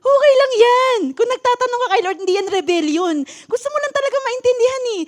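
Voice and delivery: upbeat pep to the person beside you; cheerful reassurance. Shown as a demonstration. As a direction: cheer up your neighbour warmly. Brighter cheerful repeat to neighbour.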